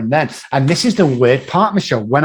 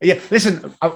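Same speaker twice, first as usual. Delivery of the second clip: ordinary talk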